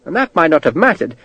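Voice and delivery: ordinary delivery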